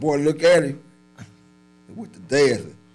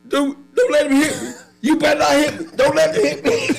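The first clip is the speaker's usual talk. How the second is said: high, loud imitated begging; mock pleading holler; 6 dB louder than usual. Man pinned on the ground begs friend, high loud mock plea. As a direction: beg not to be hit, hammed up. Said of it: "the motherfucker holler".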